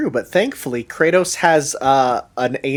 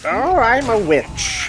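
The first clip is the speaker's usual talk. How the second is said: slow high witchy croon; witch voice; 9 semitones higher, slower than usual. Pretend. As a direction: play a cackling witch. Playful witch voice.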